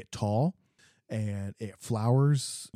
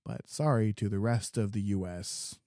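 usual speaking voice

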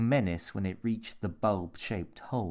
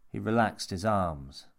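plain reading voice

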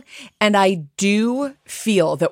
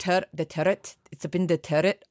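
softer, attempted Scottish accent; 5 dB quieter than usual. Shown as a demonstration. American podcaster showing co-host she can do it, attempted Scottish accent. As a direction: play at being Scottish, quietly. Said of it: "I can do the accent".

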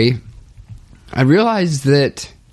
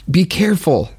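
imitating a scared mom, pitched up; scared warning; 6 semitones higher, faster than usual. Mom warns her joking sons about offending God, mildly scared imitation. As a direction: raise the pitch for a retold, anxious warning. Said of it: "Mom got so scared and was like".

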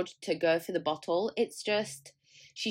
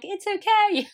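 high, overly sweet reassurance; mock soothing parent; 13 semitones higher, 7 dB louder, slower than usual. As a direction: coo it like a pushover parent. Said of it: "But again, I'm like".

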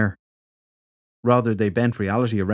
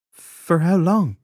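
slow, hesitant question; 8 semitones higher, 4 dB louder, much slower than usual. Acted. Shelley asks slowly.

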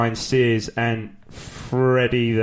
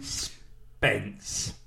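whisper; 4 semitones lower, 12 dB quieter than usual. Shown as a demonstration. Requested whispered name delivery.

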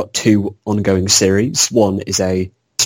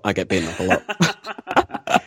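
slower, softer, through a laugh; 3 semitones lower, 4 dB quieter, slower than usual. Real, not acted. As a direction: repeat it while cracking up. Joke repeated through laughter.

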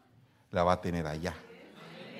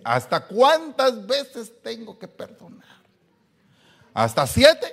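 higher, slow, incredulous plea; exasperated disciple; 11 semitones higher, 6 dB louder, slower than usual. Retold or imitated. Weary husband quoting the verse, mock exasperation. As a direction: play a fed-up man asking how long. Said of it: "aquel versículo que dice".

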